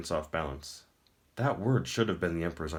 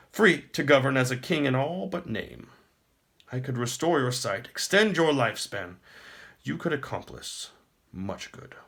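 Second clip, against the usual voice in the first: higher, louder and grand, a pleased tyrant; smug emperor; 7 semitones higher, 5 dB louder than usual. Acted. The Emperor taunts the captive prince, smug and grand. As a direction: speak down to a beaten foe.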